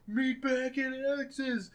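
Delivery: high, drawn-out call kept soft. Retold or imitated. One of the fleeing friends calling to the others, mock holler. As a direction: imitate a far-off holler without raising volume. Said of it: "one of them's like".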